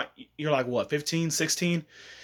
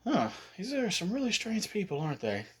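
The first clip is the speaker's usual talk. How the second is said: soft puzzled remark; quiet aside; 6 semitones higher, 8 dB quieter than usual. Retold or imitated. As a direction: say it half to yourself.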